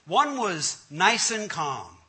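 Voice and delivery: usual speaking voice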